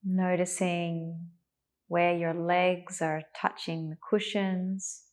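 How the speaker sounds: calm, unhurried meditation voice with long pauses; guided meditation. Real, not acted. Teacher settling a class into meditation, calm and spacious. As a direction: ease them gently into stillness, leaving space to breathe.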